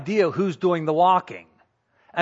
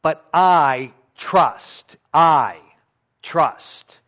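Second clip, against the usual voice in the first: slow, weighty I trust with the I leaned on; emphatic slow I trust; stressed I trust; 5 dB louder, much slower than usual. Shown as a demonstration. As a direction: lean into I, then trust. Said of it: "David says".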